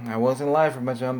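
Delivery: ordinary delivery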